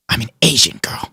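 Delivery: whisper. Pretend. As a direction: breathe it as a secret. Whispered joke.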